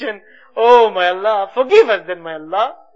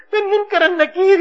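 normal talking voice

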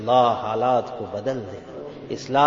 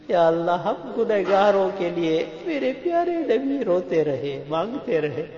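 high, grieving prayer; tearful lament; 7 semitones higher, much faster than usual. Real, not acted. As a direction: plead through rising tears. Laments the Prophet's weeping for sinners.

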